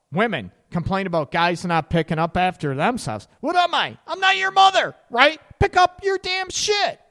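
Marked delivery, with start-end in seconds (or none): 3.4-7.0 high, loud exasperated outburst at a messy partner; fed-up partner. Acted. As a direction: snap it out like a fed-up partner who has had enough.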